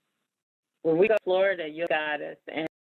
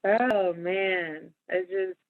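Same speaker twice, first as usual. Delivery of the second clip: ordinary talk, nothing marked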